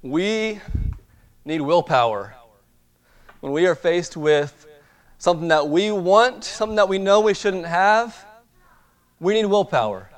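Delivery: preaching. Acted. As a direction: drive the point home from the pulpit. Preaches the lesson of temptation.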